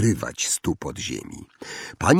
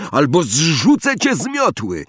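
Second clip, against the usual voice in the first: higher-pitched shouted threat, slightly restrained; Harry calling out; 7 semitones higher than usual. A narrator's reading. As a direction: threaten him across the air, pitched up. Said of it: "zawołał Harry".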